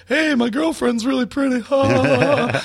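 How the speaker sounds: high-pitched, sing-out fake delight; mock cheerful gushing. Comic mocking the idea of his happy self, gushing in mock cheer. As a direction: gush like a giddy optimist. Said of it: "I'm not just the rest of the time like".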